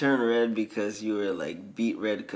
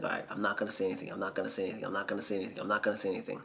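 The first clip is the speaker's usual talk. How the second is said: quick, hushed self-restraint, repeated like a mantra; rapid muttered chant of restraint; Austin Powers; 6 dB quieter, much faster than usual. Retold or imitated. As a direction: talk yourself out of blurting it, fast and under your breath. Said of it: "Austin just can't stop looking at it. And he's just like".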